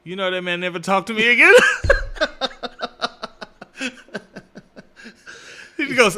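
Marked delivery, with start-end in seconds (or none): 1.6-5.7 long, high cackling laugh; big laugh. Real, not acted. Cracking up after never being talked to again, real laughter. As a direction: laugh it out in a long staccato run.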